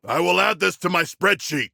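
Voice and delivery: ordinary delivery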